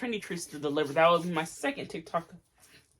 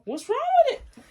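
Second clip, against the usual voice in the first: incredulous; 6 dB louder than usual. Real, not acted. Spoon question.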